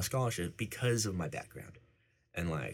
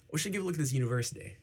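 higher, fast, put-on formal voice; mock professional tone; 9 semitones higher, much faster than usual. Son imitating himself talking business with his dad, mock professional. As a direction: play the grown-up making a serious proposal. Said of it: "Just like, all professional".

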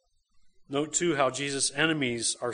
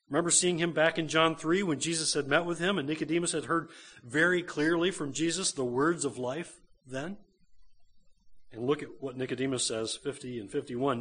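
usual speaking voice

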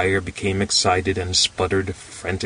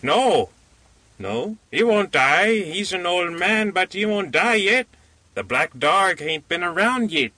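slate-colored man, insistent; 11 semitones higher than usual. A narrator's reading.

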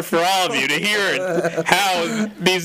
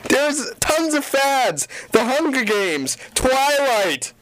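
slow exasperated; 5 semitones higher, slower than usual. Real, not acted.

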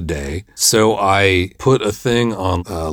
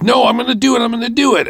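high, sing-song mocking voice; mock eager promise; faster than usual. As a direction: parody his empty promise, pitched way up. Mocking the flaky actor's promise.

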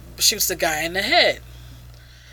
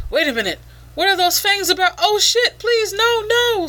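fast, much higher panicked pleading; mock panic; 12 semitones higher than usual. Reviewer voicing the shooter facing fangs, hammed-up high panic. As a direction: squeal it out like a cornered crook begging. Victim sees the vampire's fangs.

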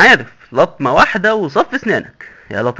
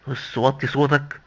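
hushed warning; 2 semitones lower, 8 dB quieter, slower than usual. Acted. Warning him to lower voice.

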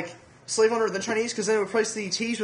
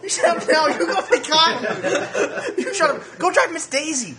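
higher and louder, a laughing mock yell; hammed-up laughing yell, much higher and louder; mock angry shout; 9 semitones higher, 5 dB louder, slower than usual. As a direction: shout it back in a hammed-up accent. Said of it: "Your accents are terrible".

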